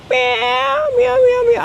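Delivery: high, far-off mewing like a cat; playful high kitten meows. Shown as a demonstration. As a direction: meow like a small cat, very high. Imitates a cat for the lesson.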